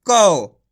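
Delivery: कौ called out with a full au; loud pron demo. Shown as a demonstration. Teacher demonstrates कौ to the class. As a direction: announce कौ loudly as the model. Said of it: "का से क्या हो जाएगा?".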